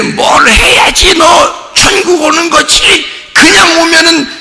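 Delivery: high, loud, slow shouted challenge; demanding God. Pretend. Preacher voicing an imagined strict God, shouting high and slow. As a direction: bark the demand down from above like a harsh judge.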